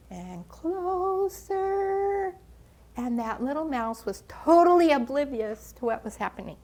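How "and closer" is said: very slow, higher drawn-out "closer"; rising suspense. A narrator's reading. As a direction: pull "closer" out long and higher as the tension peaks. Snake creeping closer.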